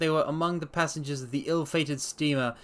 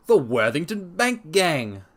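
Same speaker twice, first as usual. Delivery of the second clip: slow, louder exclamation of recognition; inspector's cry; 4 semitones higher, 5 dB louder, slower than usual. Acted. Inspector recognising the gang, slow loud cry. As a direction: lean on each word in discovery. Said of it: "cried the inspector".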